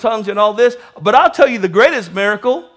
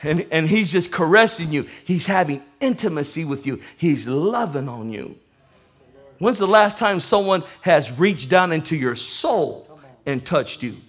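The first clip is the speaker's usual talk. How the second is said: much lower and softer, tender; tender hushed; 8 semitones lower, 9 dB quieter than usual. Acted. Pastor describing God's caress, tender and hushed. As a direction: cradle the words gently.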